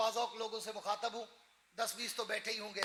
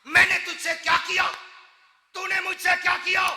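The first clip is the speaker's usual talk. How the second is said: louder questioning preaching; booming rhetorical questions; 7 semitones higher, 12 dB louder than usual. Acted. Preacher voicing God asking his servant, booming questions. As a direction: hurl the questions at the listeners. God's questions voiced loudly.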